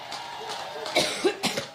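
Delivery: dry hacking coughs; coughing fit. Real, not acted. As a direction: cough hard, stopping the speech. Coughing at the podium.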